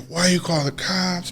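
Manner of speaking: half-hearted imitation of a complaint; bitching. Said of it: "He's, like, bitching at me".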